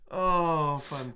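usual speaking voice

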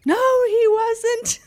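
high, loud, slow wail; hammed-up tantrum protest; mock screaming child. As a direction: imitate a kid's howling denial. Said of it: "he flipped out and was screaming".

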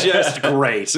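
deadpan disgust dressed up as delight; sarcastic delight. Acted. Sprayed with blood, mock delight.

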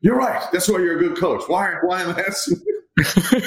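a laughing jab at the hitting coach; laughing Beltre impression. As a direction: play the star teasing his coach, laughing. Beltre's joking reply, retold.

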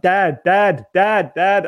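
put-on a kid calling for Dad; pestering child. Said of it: "when you've got a kid and they're trying to get your attention".

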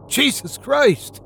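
high, slow scream of horror; horrified scream. Acted. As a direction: shriek it in sheer horror. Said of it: "Wayland screamed in horror".

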